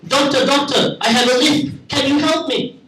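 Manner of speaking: high, strained put-on voice for a patient with a lisp; Chewbacca-style patient. Comic asking the speech therapist for help, in a high put-on voice. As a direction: squeeze it up high like a silly creature-patient. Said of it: "As if Chewbacca from Star Wars had become a speech therapist".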